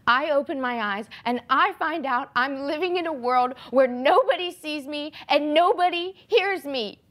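rising, desperate outburst; anguished realization. Acted. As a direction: let the hurt climb through to the end. Monologue climax, discovers nobody hears her.

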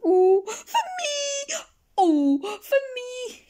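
high, slow cackling cry of greed; greedy creature voice. Acted. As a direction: drag it out high like a greedy little monster. Greedy creature claims everything.

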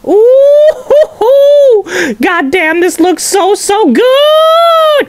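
high whooping delight, last word stretched; whoop, then gush with a soaring finish; excited gushing. Real, not acted. Gushing over food video.

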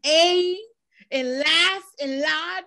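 slow, high mimicked Scottish; mock Scottish accent. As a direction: mimic a half-baked Scottish accent, drawing it out. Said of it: "that does not make you Scottish".

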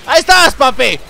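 building from loud to high, drawn-out victory yell; triumphant shouting. Real, not acted. Gamer yelling in victory as the boss dies.

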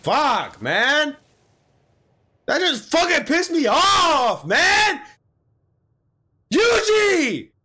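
high, loud outburst of frustration; real anger. Real, not acted. Viewer pissed off at Yuji, angry outburst. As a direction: blow up, really pissed off. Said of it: "now I'm mad".

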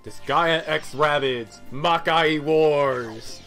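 hammed-up announcer read, stretched out; mock title announcement. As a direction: announce a silly crossover title grandly.

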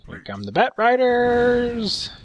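high, slow sing-out; announcing the attack. Real, not acted. Caster calling an incoming raid, drawn-out announcement. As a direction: draw out the last word high, like a herald.

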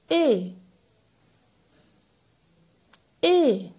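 put-on loud, drawn-out ए modelled for children; teacher sounding out ए loudly; model vowel sound. Shown as a demonstration. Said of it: "अब मैं आपको बताऊंगी छोटे ए की मात्रा की ध्वनि".

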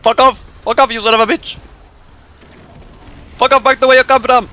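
loud, high yell of abuse; high-pitched yelling. Pretend. Gamer telling something to fuck off, high yelling. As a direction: yell it high, every word punched out. Yelling an insult during the game.

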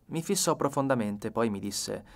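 usual speaking voice

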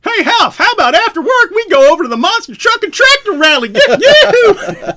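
mock country accent calling after the guard. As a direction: tease him in an over-the-top country voice. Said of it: "I would go".

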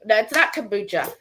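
ordinary talk

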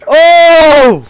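high, loud yell of triumph; excited shout. Real, not acted. Winning the game mid-review, loud high triumphant yell. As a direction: shout it out like you just won. Gamer reacts to win.

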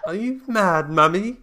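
put-on high, loud little girl voice; man doing a woman's voice. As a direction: squeal it like a creepy child. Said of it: "And she goes".

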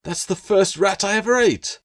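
insist, a little defensive; protesting gecko. Acted.

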